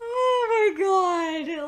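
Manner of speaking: real laughing, high and loud, dragged slowly; cracking up over the joke, drawn out. Real, not acted. Vlogger cracking up at the noisy house guests joke, laughing. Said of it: "that was great".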